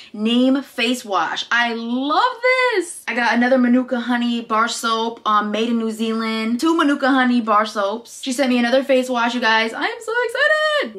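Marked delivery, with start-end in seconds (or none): 1.5-3.0 high, very drawn-out delight; gleeful love. Real, not acted. Vlogger adoring a huge face wash, gleeful. 9.7-11.0 very high squeal of delight; excited squeal. Real, not acted. Vlogger getting another face wash, excited squeal.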